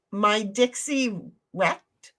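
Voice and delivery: reading chat aloud, slowly sound out "Dixie wrecked" as a name and a verb; "Dixie wrecked" in two slow, separate chunks; puzzled misreading. Retold or imitated. Streamer puzzling over a chat message, slow misreading of "Dixie wrecked". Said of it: "sometimes even the real reaction is just like".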